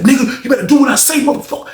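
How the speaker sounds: high, heated mock threat; forceful high-pitched imitation; violent threat, retold. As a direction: threaten like someone mid-fight. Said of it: "it sounded so violent".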